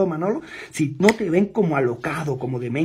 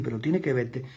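ordinary talk, nothing marked

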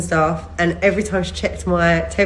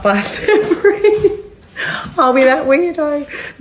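laughing; 9 semitones higher, 3 dB louder, slower than usual. Real, not acted. Laughing at herself.

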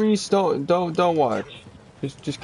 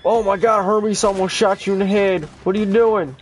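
high, loud panicked yell at the teammate; yelling high and loud in alarm; alarmed shouting; 7 semitones higher, 3 dB louder than usual. Real, not acted. Teammate nearly shot, yelling.

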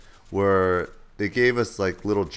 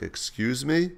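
higher, rising retort; instructor's challenging query; 6 semitones higher, slower than usual. Retold or imitated. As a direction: question it as the affronted instructor. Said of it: "she was like".

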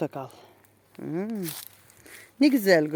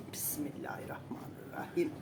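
whispered prayer; 6 semitones lower, 14 dB quieter than usual. Real, not acted. Whispered prayer before mask.

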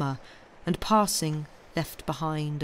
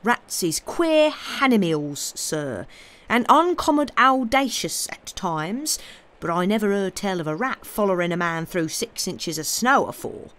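put-on sententious old servant; 2 semitones higher, 3 dB louder than usual. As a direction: lay it down like a folk sage. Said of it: "quoth Job sententiously".

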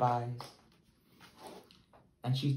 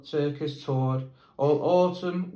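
ordinary delivery, nothing marked

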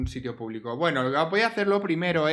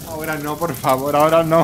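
louder, building pleading refusal; exasperated protest; 7 dB louder than usual. Real, not acted. Player begs her Sim not now, louder. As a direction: beg the game to stop, getting louder.

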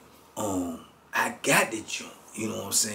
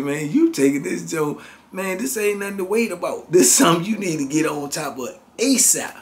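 pitched up and louder, pressing hard; urgent scolding; 7 semitones higher, 7 dB louder than usual. Retold or imitated. Narrator presses the friend to act fast, raised and urgent. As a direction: lean on him to hurry.

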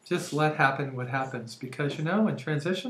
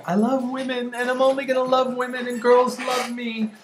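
high, sustained mock-insistent quote; loud-and-proud proclamation, played high; mock declaration; 8 semitones higher, 4 dB louder than usual. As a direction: pitch it up and stretch it out, mimicking your earlier self. Mimicking own earlier naive declaration.

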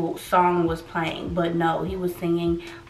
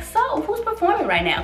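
bright, high and cheerful asking; upbeat question; 10 semitones higher than usual. Retold or imitated. Walking into the arena in a good mood, asking high and cheerful. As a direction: ask it full of good-mood excitement. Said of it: "I'm in a good mood".

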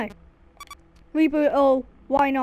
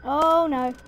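usual speaking voice

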